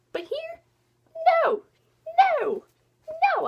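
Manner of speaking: high, loud no, no, no; anguished protest. Real, not acted. Fan yelling at June for missing, high anguished protest. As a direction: plead with the book not to let it happen. Despair at missed shot.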